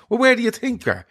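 exasperated retort. Retold or imitated. Exasperated retold rant at Gar.

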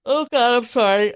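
talking through a yawn. Real, not acted. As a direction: yawn mid-word. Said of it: "I'm yawning".

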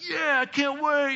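put-on eager kid. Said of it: "My attitude was".

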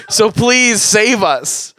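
high, drawn-out begging; desperate plea. Pretend. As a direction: slowly plead to be rescued. Begging listeners to rescue them.

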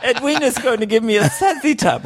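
put-on very high, prim little British kid; British-accented child. Kid answering dad's call, high British accent. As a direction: pipe up as a tiny British child. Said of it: "The kid developed a British accent".

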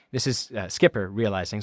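normal talking voice

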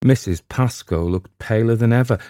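usual speaking voice

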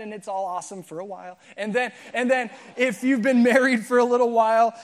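usual speaking voice

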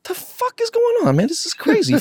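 stunned, voice shooting way up; shocked disbelief. Retold or imitated. His shocked reaction to the threat.

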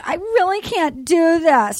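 lightly acted overwhelmed bride; imitating a woman who can't go on. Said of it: "She's like".

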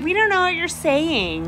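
high-pitched mock snotty roommate; snooty mimicking whine. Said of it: "they were just snotty".

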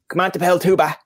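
mock Newfoundland French, a tag "ba" on the end; Newfoundland French accent. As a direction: toss off "Comment t'appelles-tu, ba" in a Newfoundland lilt. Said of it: "what we call Newfoundland French".

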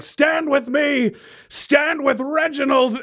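slow, high rallying call; blaring voice. Acted. Magically amplified Reginald calling supporters, blaring cry. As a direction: blare it across the arena. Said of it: "his voice is just blaring".

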